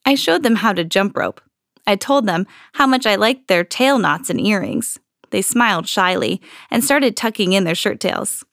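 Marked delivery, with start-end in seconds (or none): none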